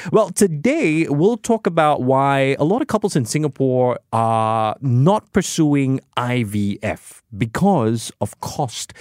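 usual speaking voice

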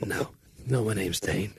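embarrassed little correction, retold quietly; imitating sheepish correction. Bandmate correcting himself to record executive, sheepish correction. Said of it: "And Dane goes".

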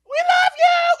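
put-on very high shouted child squeal; excited kids. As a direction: scream it like a thrilled little fan. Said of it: "they were like".

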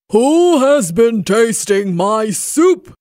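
loud, indignant Papa Bear voice; great big voice. A narrator's reading. Papa Bear demands who tasted his soup, great big voice. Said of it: "he cried in his great big voice".

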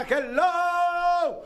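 high, bright radio hello; excited greeting. Real, not acted. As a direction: sing out the hello to the caller. Host greets a new caller.

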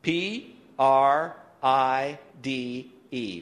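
letter by letter, very slowly; spelling out loud. Real, not acted. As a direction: spell it out for a group, pausing after each letter. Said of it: "Spell the word pride with me out loud".

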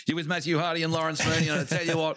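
high, fast footy commentator; sports commentator. Acted. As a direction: call it like a footy broadcast. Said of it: "I said that like a commentator".

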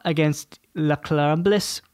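slow, slipping into a French accent. Shown as a demonstration. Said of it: "I started doing a French accent".

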